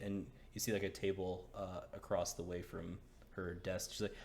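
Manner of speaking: normal talking voice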